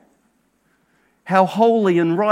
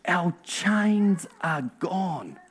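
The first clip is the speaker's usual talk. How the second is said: slow, softer quoted lyric line; quoting a song lyric, softly; 7 dB quieter, much slower than usual. Retold or imitated. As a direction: let the words land gently and slowly. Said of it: "we can say with confidence".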